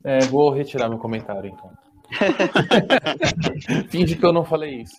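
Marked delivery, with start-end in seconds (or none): 2.1-3.8 short embarrassed laugh. Real, not acted. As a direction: laugh off the mistake. Laughs at retracting his comment.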